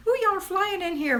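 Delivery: high, delighted squeal; thrilled at the busy chat; excited. Real, not acted. Chat filling fast.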